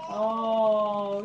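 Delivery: ordinary talk, nothing marked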